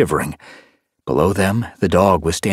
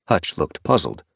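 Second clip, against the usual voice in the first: ordinary delivery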